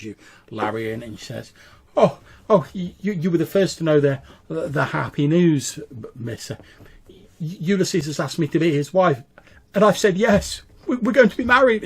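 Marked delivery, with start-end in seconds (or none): 2.0-11.8 high, loud, stammering with happiness; gushing, giddy engagement announcement; excited bride-to-be. Acted. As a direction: burst out with joyful news. Character announces her engagement.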